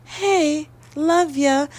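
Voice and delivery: high cutesy dog voice; dog's voice. Pretend. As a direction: voice the dog being sweet. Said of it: "'cause she's like".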